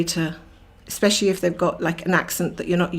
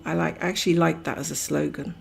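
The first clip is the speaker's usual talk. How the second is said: ordinary talk, nothing marked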